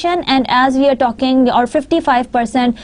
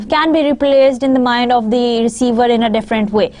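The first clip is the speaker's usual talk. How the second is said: normal talking voice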